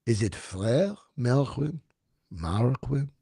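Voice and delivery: put-on soft French accent; quiet, French-accented musing; speaking French. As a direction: murmur it in playful French. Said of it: "just dropping the French".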